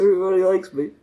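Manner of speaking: mock diva. Joking about fame, high voice.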